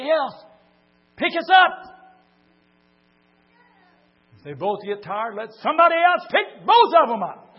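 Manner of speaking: high, loud climactic shout; full-force preaching shout. Real, not acted. Preacher urging the church to lift each other, loud high shout. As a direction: preach it at the top of your lungs.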